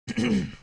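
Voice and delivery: sharp loud throat clear. Real, not acted. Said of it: "Losing my voice here".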